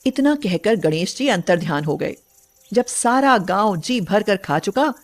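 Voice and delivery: ordinary delivery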